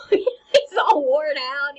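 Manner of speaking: laughing through the words; real giggle as you describe him; laughing while telling. Real, not acted. Teacher laughing at tired Hamlet, giggling. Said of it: "laughs at Hamlet's state".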